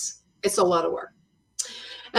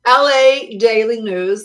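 ordinary talk

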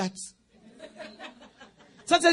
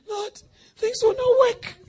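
whiny worry; 9 semitones higher, 4 dB louder than usual. Retold or imitated. Voicing an anxious believer.

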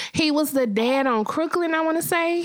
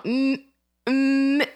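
ordinary talk

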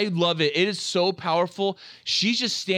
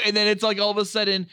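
usual speaking voice

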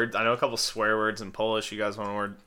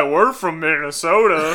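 louder, higher, drawn-out teasing voice; mocking imitation of friend; 5 semitones higher, 7 dB louder, slower than usual. Teasing a friend about his roots, loud drawn-out mock voice. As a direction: stretch it out, mocking with a laugh. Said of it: "And Chris is like".

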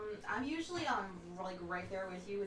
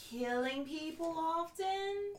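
sweet high voice; 7 semitones higher, much slower than usual. Acted. Player talks to chipmunk sweetly.

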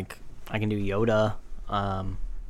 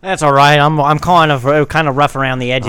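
louder put-on Australian bloke voice; Jack Wallaby, Australian accent; 3 semitones higher, 7 dB louder than usual. Brushing off the host as rough round the edges, Australian accent. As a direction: laugh it off as a loud Australian. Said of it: "Australians are".

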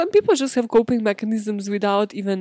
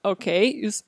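ordinary delivery

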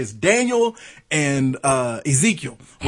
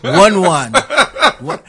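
half-managed Mexican accent, played for laughs; attempted Mexican accent; 4 dB louder than usual. Comic imitates his handyman neighbor, in a shaky Mexican accent. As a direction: have a clumsy go at a Mexican accent. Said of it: "if I could do a Mexican accent".

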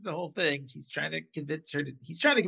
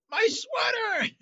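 high, drawn-out mock wail; Larry; much slower than usual. Larry as the ambulance drives away, high mock wail. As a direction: imitate an exaggerated, outraged yell after something lost. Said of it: "in that Larry way".

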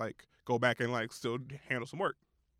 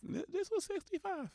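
squeaky outrage; 6 dB quieter than usual. Real, not acted. Outraged at ticket price.

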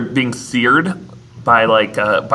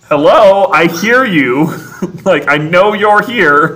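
hammed-up hello to hidden voices; mock calling out; 4 semitones higher, slower than usual. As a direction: Maurice calling to the whispering objects, hammed-up.

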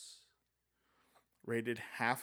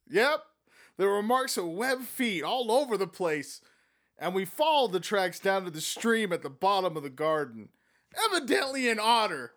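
Egbert; 6 semitones higher, 6 dB louder than usual. Acted. Egbert's lines retold.

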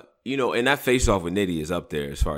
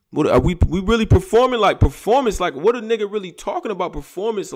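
high-pitched, loud mock indignation; Mook; 10 semitones higher, 4 dB louder than usual. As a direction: imitate an incredulous rant, pitched way up. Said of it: "You heard Mook".

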